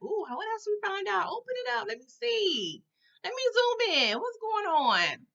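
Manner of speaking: high, eager mock voice demanding to see the file; leaning in, hungry for the gossip; nosy excitement. Pretending to peek into the file folder, eagerly high.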